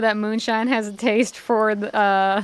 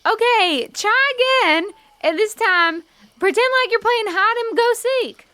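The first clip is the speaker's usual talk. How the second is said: coaxing the gull; 11 semitones higher, 3 dB louder than usual. Acted. Player speaking to the gull.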